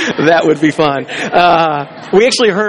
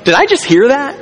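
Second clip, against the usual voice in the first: incredulous; 9 semitones higher than usual. Retold or imitated. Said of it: "And you're like".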